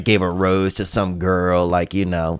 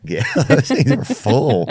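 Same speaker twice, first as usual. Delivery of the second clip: ordinary delivery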